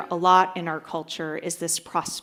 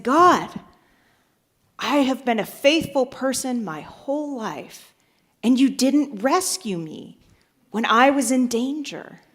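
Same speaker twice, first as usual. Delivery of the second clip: higher-pitched, acted protest; mild indignant complaint; 6 semitones higher, 4 dB louder than usual. Faithful man to God at pearly gates, mildly indignant. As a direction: plead your case with a touch of reproach.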